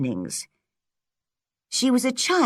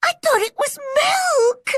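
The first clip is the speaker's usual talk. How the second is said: very high, tearful wail; girl sobbing over her ruined cake; 3 dB louder than usual. A narrator's reading. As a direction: cry the excuse through sobs. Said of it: "sobbed Anne".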